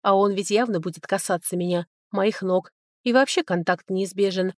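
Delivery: plain reading voice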